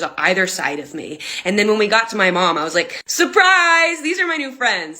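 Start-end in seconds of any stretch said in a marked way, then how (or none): 3.1-5.0 very high, loud sing-song surprise; mock cheerful surprise. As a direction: present them brightly to mom. Cheerful presenting police to mom.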